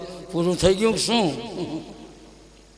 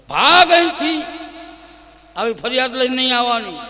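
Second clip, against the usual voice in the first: much higher, louder scolding; angry dismissal; 9 semitones higher, 10 dB louder than usual. Acted. Yashoda chases Prabhavati off, angry shout. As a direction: chase her out angrily. Yashoda drives her away.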